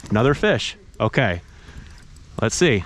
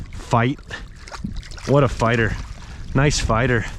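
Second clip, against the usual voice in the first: usual speaking voice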